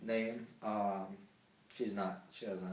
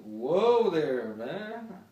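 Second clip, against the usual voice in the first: louder, amused whoa there; mock whoa; 8 semitones higher, 11 dB louder, much slower than usual. He reacts to her scream, loud amused whoa. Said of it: "Well, I was like".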